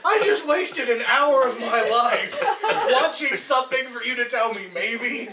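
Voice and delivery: loud, high comic outrage; exasperated rant. Acted. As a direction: yell it in drawn-out mock exasperation. Exasperated viewer rant after credits.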